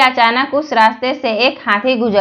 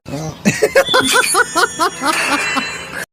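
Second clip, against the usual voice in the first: high, light laugh; a high giggle at the punchline; laughter; 8 semitones higher than usual. Real, not acted. Laughing at Santa's punchline, high and light.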